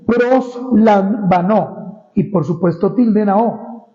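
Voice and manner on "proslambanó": "proslambanó" said very slowly, syllable by syllable, accent on the final O; spelled-out Greek word. Shown as a demonstration. Preacher teaching the congregation the Greek verb, very slow and careful. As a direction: draw out "proslambanó" slowly with stress on the last O. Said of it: "con M".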